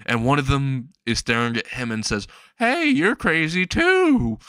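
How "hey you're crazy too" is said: very high, drawn-out cracked hobo voice; hammed-up hillbilly hollering a greeting; crazy hillbilly. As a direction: holler it high and slow like a loon. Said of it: "has an actual crazy hillbilly hat on".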